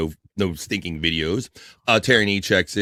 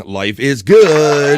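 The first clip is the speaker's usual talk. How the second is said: louder and higher, very slow; mock-grand declaration; slow grand reading; 4 semitones higher, 6 dB louder, much slower than usual. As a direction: savour it like a toast. Reading with gusto.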